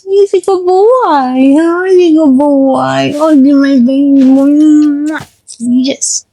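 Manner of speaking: doting pet voice; dog praise. Real, not acted. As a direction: fuss over the dog.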